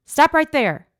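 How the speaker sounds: high, loud command to halt; sharp warning. A narrator's reading. Sanchez halting a stranger from the shadows, warning shout. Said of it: "Sanchez warned".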